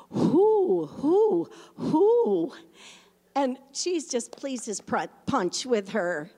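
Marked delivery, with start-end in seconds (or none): 0.1-2.5 higher, louder hoo hoo hoo in an owl-like voice; owl hooting. Retold or imitated. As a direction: hoot like a little owl. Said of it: "And she goes".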